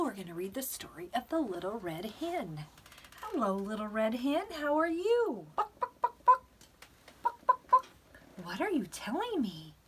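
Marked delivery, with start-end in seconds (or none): 3.2-5.5 high hen voice. Acted. 5.6-7.9 high squeaky hen clucks; clucking hen. Acted.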